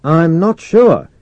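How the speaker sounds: slow, high, loud sarcastic reply; projected mock doubt; echoed sarcasm. A narrator's reading. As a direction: drag it out, echoing the other man's sarcasm. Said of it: "echoing the sarcasm in Mercer's voice".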